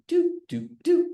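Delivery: high, sharp imitated clock beeps; ticking clock beeps. Jack Bauer fan mimicking the 24 clock, in high sharp beeps. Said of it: "no cool clock that goes do".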